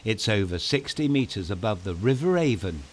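usual speaking voice

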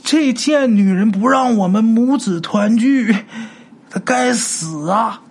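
put-on high, slow, venomous old-woman voice; vicious ghost grandmother. Ghost grandma cursing the woman keeping her from her son. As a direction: drag out the curse like a crazed ghost.

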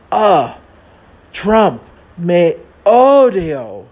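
telenovela-style declaration, slow and loud; hammed-up soap-opera line, drawn out; very dramatic. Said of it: "So very dramatically, I said".

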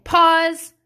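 loud, high one-word command; calling out pause. Shown as a demonstration. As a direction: call it out over talking kids. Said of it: "So lately I've just been saying".

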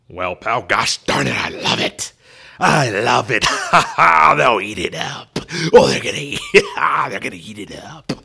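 very loud, cackling, crying with glee; Vince McMahon impression. Boss thrilled by Stone Cold's pitch, laughing loudly. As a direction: howl with delight like an overjoyed boss. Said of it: "Vince McMahon just saying".

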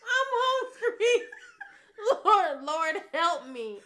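high, loud giggling plea to God; laughing mock prayer. Praying to the Lord about hunger, laughing. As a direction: pray for food while cracking up. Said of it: "I was like".

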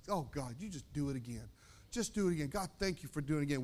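low, soft plea to God; quiet prayer. Acted. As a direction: murmur a grateful prayer. Acts out prayer of thanks.

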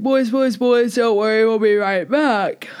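words stretched into a yawn. Real, not acted. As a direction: talk through a rising yawn, slowing at the end. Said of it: "I'm yawning".

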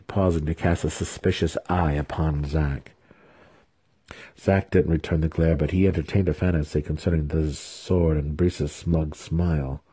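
ordinary delivery, nothing marked

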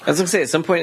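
ordinary talk, nothing marked

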